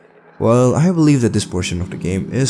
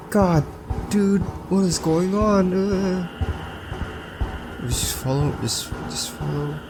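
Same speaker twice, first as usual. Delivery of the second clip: scared horror game streamer; 8 semitones higher, 5 dB quieter than usual. Real, not acted. Nervous search in dark cargo hold.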